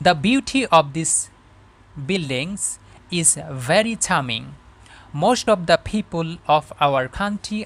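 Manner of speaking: very slow, deliberate English read-aloud; careful model reading. Shown as a demonstration. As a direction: read it phrase by phrase for learners, with long pauses. (ধীরে ধীরে, থেমে থেমে আদর্শ পাঠ)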